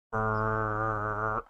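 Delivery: rolled brrr like a revving machine; machine whirr. Shown as a demonstration. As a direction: buzz it like a motor spinning up. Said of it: "almost sounds like a".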